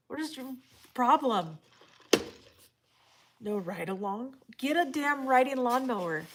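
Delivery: loud, playful scolding; playfully outraged at a viewer; mock exasperation. Real, not acted. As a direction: tease them loudly for it. Mock outrage over push mower.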